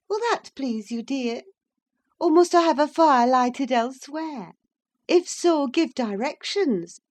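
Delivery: wife mocking husband's snobbery, high and loud; Catherine, half angry half laughing. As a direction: tease him, sharp and gleeful. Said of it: "half angry, half laughing".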